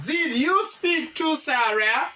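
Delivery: slow English question rising at the end; slow model sentence. Shown as a demonstration. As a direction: model it slowly for the class. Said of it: "Est-ce que ou te pale a Sarah?". (kesyon an dousman)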